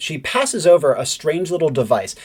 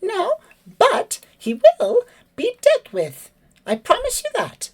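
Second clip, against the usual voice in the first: very high, slow, firm promise; high firm bird voice; firm bird lady; slower than usual. Acted. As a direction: reassure him firmly. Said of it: "She says".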